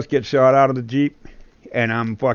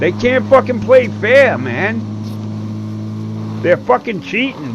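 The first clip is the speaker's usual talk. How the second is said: loud, higher angry rant; angry complaint; 9 semitones higher, 4 dB louder than usual. Real, not acted. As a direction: vent at the cheaters. Angry at being killed.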